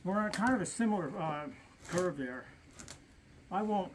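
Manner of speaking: ordinary delivery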